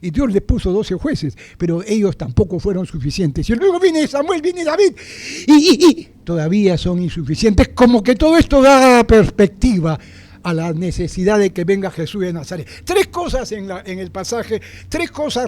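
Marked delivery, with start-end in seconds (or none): 3.5-10.0 higher, louder preacher's build; fervent sermon climax; preaching at full force. Acted. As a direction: rouse the congregation. Preaching rises again.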